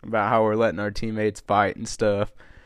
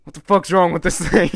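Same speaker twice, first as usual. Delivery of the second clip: higher, louder mock fury; retold angry outburst; 7 semitones higher, 9 dB louder than usual. Coach yelling at a broken truck door, hammed-up anger. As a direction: imitate the coach losing it at the door. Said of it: "he literally got so mad about that".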